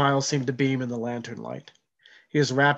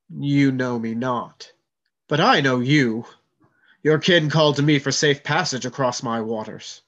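thunderous voice; 5 dB louder than usual. Acted. Spirit in the boy declares itself to John, thundering. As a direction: speak like the storm itself. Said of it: "His voice was like thunder".

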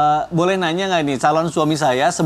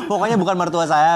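ordinary talk

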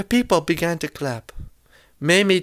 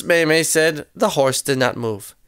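ordinary delivery

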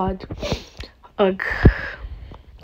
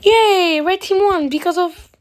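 higher, louder burst of celebration; cheering; 6 semitones higher, 3 dB louder than usual. Real, not acted. Gamer cheering for the red team's win, excited.

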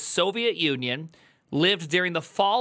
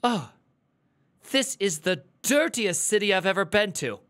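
put-on dismay with long pauses; imitating appalled friend; 2 semitones higher than usual. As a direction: play the friend's appalled verdict. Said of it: "he's like".

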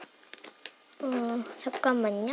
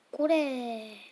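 usual speaking voice

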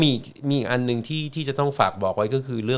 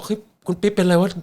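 wife's alarmed question; 7 semitones higher than usual. Retold or imitated. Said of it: "ไอ้คุณดวงก็บอก".

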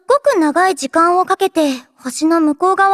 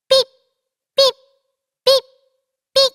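mock bomb beeps; 5 semitones higher, much faster than usual. Bomb on head beeping.

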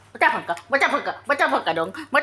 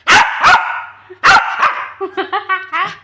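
loud laugh; 6 semitones higher, 6 dB louder than usual. Real, not acted. Laughing at the angry pet.

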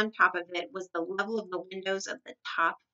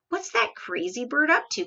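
raised, exclaiming voice; cried question; 6 semitones higher than usual. A narrator's reading. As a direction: ask it in high-pitched bewilderment. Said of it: "cried the Duke".

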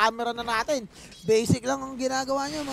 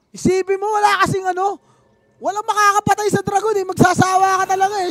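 excited; 7 semitones higher, 9 dB louder than usual. Real, not acted. Excited commentary on unkillable dragon.

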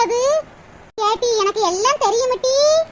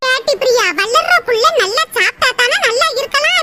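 ordinary talk, nothing marked

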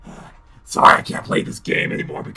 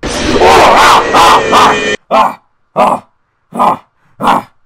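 jump scare screams; 15 dB louder, much slower than usual. Real, not acted. Jump scare in horror game.

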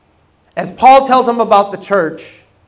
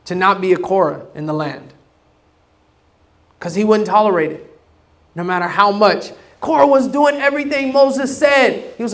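normal talking voice

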